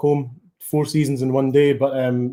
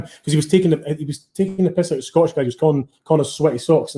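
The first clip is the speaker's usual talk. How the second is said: normal talking voice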